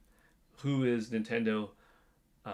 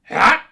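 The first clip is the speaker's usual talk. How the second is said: sharp high yell "Hah!"; Link battle cry; 19 dB louder than usual. Pretend. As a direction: shout a short heroic grunt. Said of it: "can only grunt and scream".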